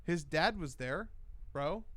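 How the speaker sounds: ordinary talk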